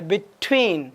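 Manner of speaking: between, leaning hard on the T; stress on the T. Shown as a demonstration. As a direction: punch the T that opens the second half of between. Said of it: "टी के ऊपर जोर दिया जाता है". (between में टी पर ज़ोर)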